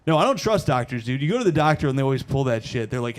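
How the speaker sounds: ordinary delivery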